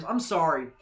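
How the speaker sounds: usual speaking voice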